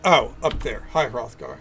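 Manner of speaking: usual speaking voice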